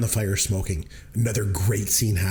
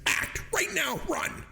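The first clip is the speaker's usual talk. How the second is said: imitating a director's frantic command, very high; high-pitched mock yell at child actors; mock screamed order; 4 dB quieter, slower than usual. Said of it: "he started screaming at them".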